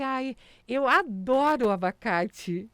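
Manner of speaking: slow, loud, delighted; excited gush. Retold or imitated. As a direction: gush over the avocado. Excited child asking for avocado.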